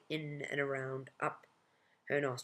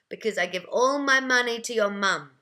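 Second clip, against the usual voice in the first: high, loud, mischievous outburst; old woman's exclaimed comeback; loud blurt; 6 semitones higher, 10 dB louder than usual. A narrator's reading. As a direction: blurt it out as a cheeky retort. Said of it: "she blurted with a mischievous look".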